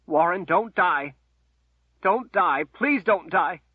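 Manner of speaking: high, loud pleading through sobs; choking tears. Acted. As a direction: beg him desperately not to die. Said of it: "past her choking tears".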